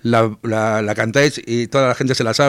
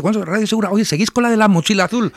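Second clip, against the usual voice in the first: higher-pitched mock listener request; imitating eager listeners; 9 semitones higher than usual. Listeners asking Radio Segura for La Mochila Azul, higher, imitated plea. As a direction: voice an insistent caller, pitched up. Acts out people pestering the radio host.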